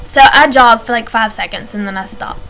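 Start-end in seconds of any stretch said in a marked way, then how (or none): none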